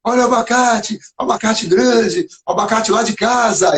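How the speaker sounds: put-on boy hawking his avocados at the fair, lightly; mock market yell. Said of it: "Aí eu comecei a gritar".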